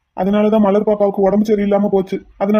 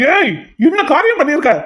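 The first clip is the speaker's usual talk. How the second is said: aghast shout; outraged shout; 8 semitones higher than usual. Acted. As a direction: yell at him in horror. Shocked outrage.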